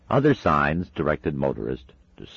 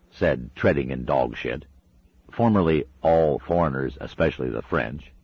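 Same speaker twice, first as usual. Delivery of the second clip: ordinary delivery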